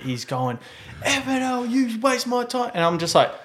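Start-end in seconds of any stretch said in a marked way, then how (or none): 1.0-2.7 mock hissy fit. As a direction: imitate a kid's tantrum, pitched way up. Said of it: "like a kid having a hissy fit".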